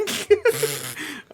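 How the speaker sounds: real high-pitched laugh. Real, not acted. Laughs at start of story.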